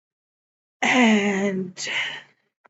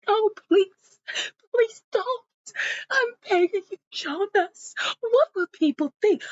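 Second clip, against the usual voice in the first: high, tearful mock pleading; desperate begging; 11 semitones higher than usual. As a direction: act out a wife begging him to stay. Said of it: "she's just begging him and begging him".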